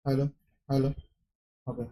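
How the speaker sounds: ordinary talk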